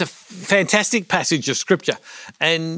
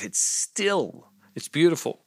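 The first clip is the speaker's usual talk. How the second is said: hushed, soft stillness; hushed calm; 5 dB quieter than usual. Acted. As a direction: drop to a soft hush on the stillness. Describing perfectly calm water.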